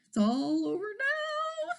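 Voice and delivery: high, slow sing-song despair; despairing dragon. A narrator's reading. Dragon thinking he's caught, high slow despair. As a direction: moan it like all hope is gone. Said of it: "thought the dragon".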